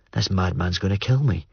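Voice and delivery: plain reading voice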